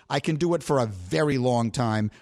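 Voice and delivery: ordinary talk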